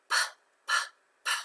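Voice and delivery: "P" as bare puffs of air, repeated separately; unvoiced p. Shown as a demonstration. Teacher tests whether P is voiced, unvoiced "p" puffs. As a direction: pop "p" without any voice, not the letter name. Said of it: "It's not voiced".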